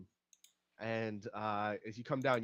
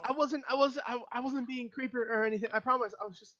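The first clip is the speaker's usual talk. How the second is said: high, stammering protest; frightened pleading; 12 semitones higher, 6 dB louder than usual. Acted. GM voices the freed man explaining himself, frightened. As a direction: stammer excuses in a high panic. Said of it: "He goes".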